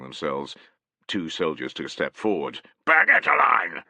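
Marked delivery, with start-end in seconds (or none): 2.8-3.8 loud bellowed order to the ranks. Acted. Centurion bellowing at his soldiers, loud. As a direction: bark it like a centurion. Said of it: "bellowed Tallis".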